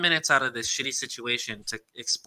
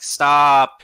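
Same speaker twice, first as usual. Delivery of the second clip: loud shout through glass; mock yell while banging on a locked door; imitated desperate yell; 2 semitones higher, 9 dB louder than usual. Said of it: "Joan, like, bangs on the glass".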